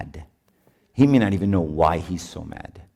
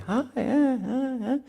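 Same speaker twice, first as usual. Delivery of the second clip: strained, much higher, slow forced hello; a hello pushed out through clenched politeness; awkward fake greeting; 4 dB quieter, slower than usual. Priest mocking a fake church greeting, high and strained.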